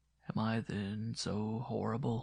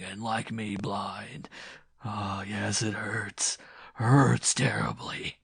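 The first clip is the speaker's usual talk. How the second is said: slow, breath-heavy rant surging loud; vengeful gloating; 5 dB louder, slower than usual. Acted. Man tells the woman his revenge, cruelly gloating. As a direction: swell into cruel triumph, drop back, then surge.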